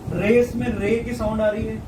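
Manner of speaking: usual speaking voice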